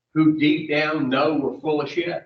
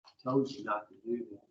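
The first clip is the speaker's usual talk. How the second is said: inner voice scolding; 6 semitones lower, 11 dB quieter, faster than usual. Retold or imitated. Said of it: "that voice come back a little louder".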